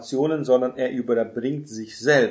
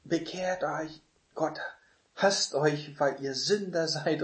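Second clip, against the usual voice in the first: mock yelling; 4 semitones higher, 3 dB quieter than usual. Shown as a demonstration. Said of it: "den Leuten anschreit".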